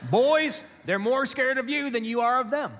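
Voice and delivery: very high, loud calling-mom voice; imitated mom. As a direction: sing out a mom's stock line to the boys. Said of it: "She'd say".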